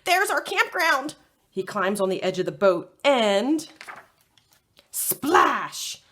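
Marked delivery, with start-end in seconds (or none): 0.0-1.2 excited high yell; dog yelling upon spotting home. Acted. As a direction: shout it out with excitement. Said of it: "Fred yells".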